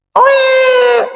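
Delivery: a sudden high cry of "oh iya"; sudden realisation. Acted. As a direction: jump up as the answer finally clicks. Preacher acts out a sudden realisation. (berseru tinggi dan kaget)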